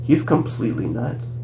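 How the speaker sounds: soft, confiding undertone; hushed aside; whispered. A narrator's reading. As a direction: drop your voice so only one hears. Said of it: "Bianca whispered to me".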